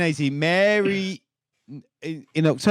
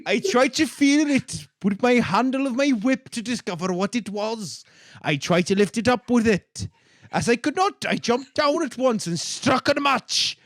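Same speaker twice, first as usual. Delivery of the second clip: put-on higher, animated comic Welsh accent; funny Welsh accent; 5 semitones higher than usual. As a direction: read it out in an increasingly lively Welsh accent. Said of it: "in a funny accent".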